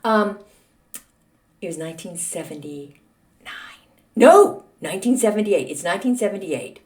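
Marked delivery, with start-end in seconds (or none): 1.6-3.0 soft, secretive whisper; stage whisper. Pretend. Vlogger starting a seventies confession, stage whisper. 4.1-4.7 loud, high "no"; self-correction. Real, not acted. Vlogger catching her wrong year, loud self-correction.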